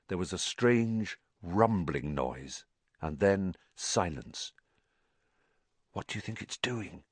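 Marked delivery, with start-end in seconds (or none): 5.9-7.0 soft, hushed question; Harry asks Ron about the unseen creature. A narrator's reading. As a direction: ask it barely above a breath. Whispering in the dark.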